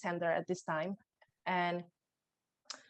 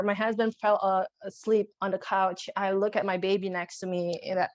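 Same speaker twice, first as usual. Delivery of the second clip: ordinary delivery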